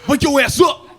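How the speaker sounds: hammed-up furious wake-up call; mock angry shout. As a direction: act out a dad yelling someone awake. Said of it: "I got mad".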